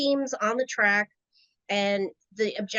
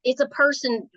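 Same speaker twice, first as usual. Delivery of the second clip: usual speaking voice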